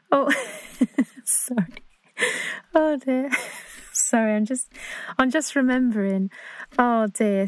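giggling apologies through real laughter; real laughter spilling over the words; laughing at a memory. Real, not acted. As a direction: break up laughing while trying to carry on. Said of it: "so funny".

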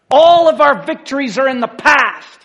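loud, high, impassioned cry; worked-up pulpit shout; excited preaching. Real, not acted. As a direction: preach it out at full force. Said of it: "I got a little excited".

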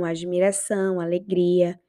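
ordinary delivery

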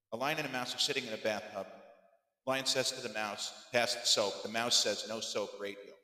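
quick, soft rundown of a joke; deadpan recap. Acted. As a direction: rattle the joke off with no punch. Rattles the joke off again.

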